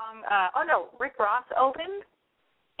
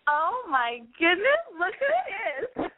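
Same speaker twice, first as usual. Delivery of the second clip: gushing red carpet chatter; 8 semitones higher, much slower than usual. Pretend. Mock red carpet greeting.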